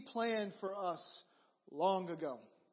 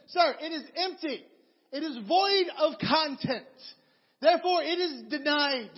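high, loud, pompous cashier refusal; mock stern cashier; 5 semitones higher, 5 dB louder than usual. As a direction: ham up an officious clerk building to a shout.

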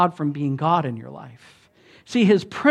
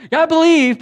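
mock eager yes; 11 semitones higher, 8 dB louder than usual.

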